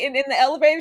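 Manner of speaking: ordinary talk, nothing marked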